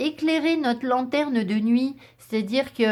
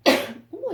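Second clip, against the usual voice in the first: sudden loud sneeze; sneezing; 12 semitones higher, 3 dB louder than usual. Real, not acted. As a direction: let out a real sneeze. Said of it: "j'éternue". (éternuement soudain)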